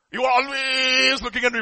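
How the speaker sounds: high, loud, exasperated protest; loud complaint. Acted. Job protests God's constant gaze, acted loud complaint. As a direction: complain loudly, leaning on "always".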